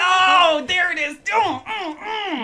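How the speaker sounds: high pleased humming; delighted. Real, not acted. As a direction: savour the song, thrilled. Song comes on, delighted.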